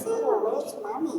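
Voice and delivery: put-on very high baby-talk voice; Guilmon voice. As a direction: coo it like a cartoon pet. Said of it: "I'll be like".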